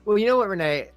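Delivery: ordinary talk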